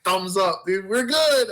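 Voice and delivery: loud, lifted mock assurance; upbeat bravado. Acting him out after the grazed ear, upbeat bravado. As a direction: call it out like all is fine.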